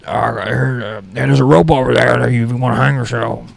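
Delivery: put-on a fussy old guy barging into the garage; crazy old guy. Old roommate interrupting to park his car, fussy put-on voice. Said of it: "I live with this crazy old guy".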